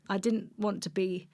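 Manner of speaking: normal talking voice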